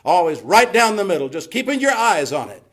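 full-force preaching. Acted. Preacher urging the congregation to stay down the middle, forceful. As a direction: drive it home to the congregation.

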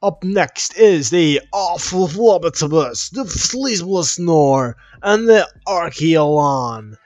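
loud, drawn-out showman's introduction; mock tour announcer. Gamer leading a museum tour of fossils, mock announcer. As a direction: announce each name with booming relish.

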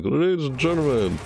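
high, swooping showman's announcement; mock ringmaster sweeping up and down in pitch; Junkrat. Gamer mimics the trailer's showman introduction, Junkrat impression. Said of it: "his Junkrat's like".

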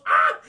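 short loud cry on being flung; mock impact cry. Spider-Man flung at a wall, loud mock cry. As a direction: shout out as if slammed into something.

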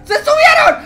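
panicked high shout. Real, not acted. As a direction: scream it in panic. Repeated, rising panic.

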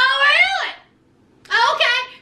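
high, sarcastic "oh really"; mock surprised. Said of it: "Andrea's like".

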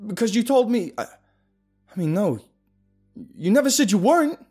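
loud sharp answer, faltering midway. Acted. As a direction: snap back, then stumble. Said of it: "I answered sharply".

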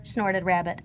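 usual speaking voice

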